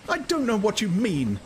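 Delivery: high, set woman's voice; a woman shuts down the talk; Lenina, stubborn. A narrator's reading. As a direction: repeat it, refusing to follow. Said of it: "she repeated".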